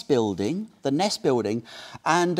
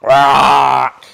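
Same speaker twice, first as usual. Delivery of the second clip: loud, drawn-out crow caw; crow squawk; 14 dB louder than usual. Retold or imitated. Female crow at the unmoving male, loud squawk. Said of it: "she keeps it up".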